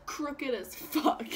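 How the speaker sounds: ordinary delivery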